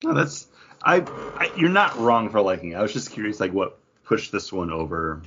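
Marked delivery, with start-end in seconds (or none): none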